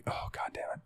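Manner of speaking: whispered exasperated aside; muttered aside. Retold or imitated. Worker's private reaction as the boss starts talking, whispered. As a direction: curse under your breath, barely audible.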